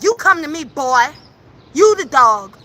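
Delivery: usual speaking voice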